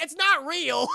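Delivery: loud, high yell at the screen; yelling it's not real; shouted warning. Real, not acted. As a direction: scream the warning at her. Streamer yells at character.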